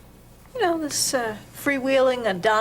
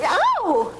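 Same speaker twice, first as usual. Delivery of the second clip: high, sudden yelp of pain; cry of pain; 8 semitones higher, 3 dB louder than usual. Acted. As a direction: yelp as the therapist hurts you. Hurt during physical therapy.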